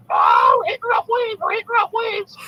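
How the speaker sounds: very high, shouted parrot voice; squawking parrot. Acted. Parrot being shaken by the pirate in a joke, high screeched shout. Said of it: "and the parrot's like".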